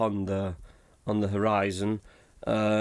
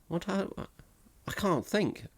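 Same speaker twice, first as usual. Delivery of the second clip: ordinary talk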